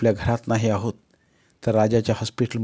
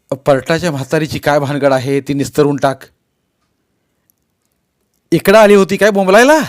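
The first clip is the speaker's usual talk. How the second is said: scolding father; 3 semitones higher, 10 dB louder than usual. Acted. Father scolding his son.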